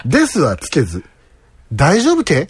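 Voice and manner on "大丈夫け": higher-pitched Toyama question ending in け; casual Toyama-accented question, pitched up; natural Toyama dialect. Shown as a demonstration. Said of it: "自然な富山弁だと思います。".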